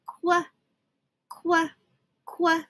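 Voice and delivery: crisp "qua" repeated; sounding out Q for queen. Shown as a demonstration. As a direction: model the kw sound. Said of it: "Q says qua".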